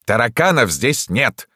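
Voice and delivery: loud, high, slowed shout; indignant yell. A narrator's reading. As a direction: holler from another room. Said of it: "завопил из кабинета доктор".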